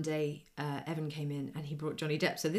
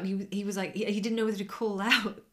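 ordinary delivery

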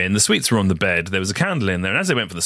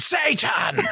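high, sudden shout of Satan. Retold or imitated. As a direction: yell it like a crazed priest.

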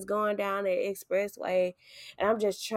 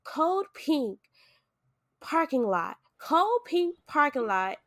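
higher call like an overhead page; hospital page; 6 semitones higher than usual. Retold or imitated. Staff calling the code across the lot, paging call. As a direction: announce the code over the speaker. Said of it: "and I hear".

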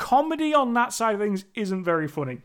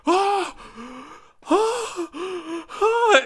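high, hammed-up repeated "Ah!"; mock shocked gasps; 12 semitones higher, much slower than usual. Mimicking the wife's over-the-top reaction, mock shocked gasps. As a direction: imitate a badly acted gasp of shock. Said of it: "face is just like".

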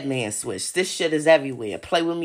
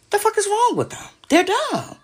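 high exasperated outburst; 13 semitones higher than usual. Real, not acted. Asking viewers what's wrong with them, high and exasperated. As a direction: pitch leaps high in disbelief.

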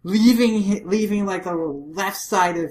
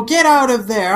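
loud, raised warning shout; yelling; 7 semitones higher, 9 dB louder than usual. Acted. Matsudaira warning them as the blast charges, yelling. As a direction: bellow a warning from afar. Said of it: "he yells at them".

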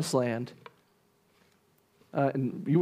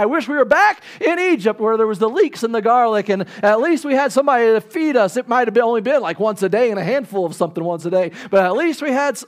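loud, high, self-pitying griping; whiny complaint; 6 semitones higher, 5 dB louder than usual. Acted. Israelites grumbling to Moses, loud and whining. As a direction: moan about the leeks and garlic of Egypt.

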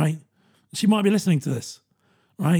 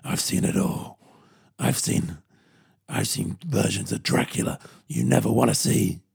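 hammed-up low world-weary mentor voice; grizzled jaded veteran; 6 semitones lower than usual. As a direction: growl it like a weary wild-west veteran, then bark the last line. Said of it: "That's what I felt like".

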